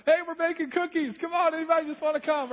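high, loud, sing-song welcome; chirpy host waving everyone in; cheery hostess voice. Retold or imitated. Hosts inviting people in warmly.

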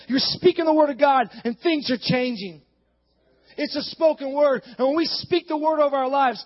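punched, mounting preacher's cadence; fervent preaching. Acted. Preacher declaring a life verse to church, fervent preaching. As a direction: hammer out each phrase with conviction.

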